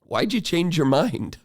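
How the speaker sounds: teacher puzzled asking the principal; curious question. Retold or imitated. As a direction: ask like you can't believe it. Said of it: "I said".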